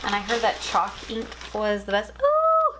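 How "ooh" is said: delighted squeal. Real, not acted. Gasping at new washi tape.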